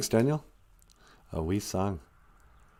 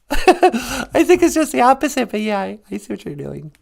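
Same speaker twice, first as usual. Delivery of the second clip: higher put-on old man voice, teasing; Papa Wagosh voice; 10 semitones higher, 3 dB louder than usual. Papa Wagosh teasing the host about Indian time, higher put-on voice. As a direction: lift the voice up as the puppet chuckling back.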